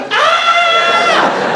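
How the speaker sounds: very high, held shriek; mock girl's scream. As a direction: squeal like a panicked schoolgirl. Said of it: "tried to tell the, the teacher".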